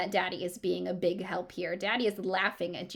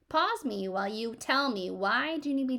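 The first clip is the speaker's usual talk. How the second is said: plain reading voice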